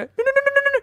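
panicked high no's; frantic, high-pitched panic; freaking out. Retold or imitated. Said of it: "where I freak out and I go".

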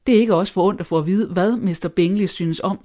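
ordinary delivery

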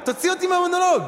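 high, fast, half-laughing mock panic; mock desperate pleading. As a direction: beg him to stop, playfully frantic. Pleading with sound guy, escalating.

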